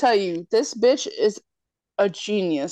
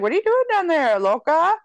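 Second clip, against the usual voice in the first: a touch higher, teasing, laughing guy voice; lightly voiced Keith; 7 semitones higher than usual. Retelling Keith teasing the girl, lightly voiced. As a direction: tease her from the doorway. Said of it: "He's like".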